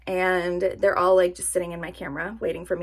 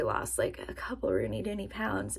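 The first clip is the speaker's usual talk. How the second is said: whisper; 9 dB quieter than usual. Real, not acted. Hopeful weight guess.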